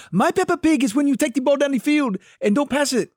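a loud, hammed-up Spanish coach voice; Spanish accent. Soccer coach explaining his pet peeve to the girls, in a mock Spanish accent. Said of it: "He goes".

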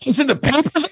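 very high, shocked mock outcry; horrified lion. Lion learns gorilla's deed made the news, shocked outcry. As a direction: shriek the punchline in hammed-up disbelief. Punchline: lion's horrified reaction.

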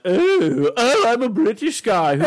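high, loud mock British accent. Joking that the clerk wasn't a British guy, mock British accent. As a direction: ham up a posh British guy. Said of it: "he wasn't like".

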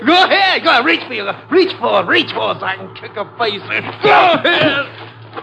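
high, excited goading shout; frenzied taunt, repeated and pushed high; shouted dare. Acted. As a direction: goad them wildly, voice jumping up. Gunman dares constable to reach.

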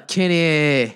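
Kenny stretched out slowly; long, lingering Kenny; drawn-out Kenny. Real, not acted. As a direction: draw out the name Kenny like an introduction. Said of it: "Every time I say Kenny like that".

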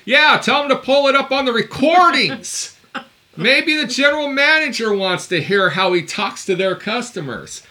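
loud, high heckling shout; yelling from the background. Retold or imitated. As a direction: call out over someone's phone call. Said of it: "you hear me in the background going".

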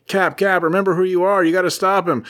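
Bucky lightly pleading with brainwashed Cap; urgent plea. Retold or imitated. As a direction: snap a friend out of it. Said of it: "And he's like".

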